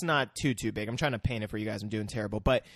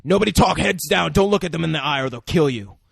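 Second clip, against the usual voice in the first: loud, forceful warning; acted action-hero orders; urgent command; 2 semitones higher, 6 dB louder than usual. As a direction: order everyone down, fast and firm.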